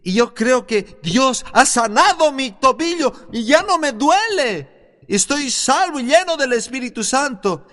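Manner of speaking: high, exclaiming retold voice; excited testimony. Healed man telling the preacher his ankle pain is gone, excited testimony. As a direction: imitate a man amazed and elated, pitch climbing.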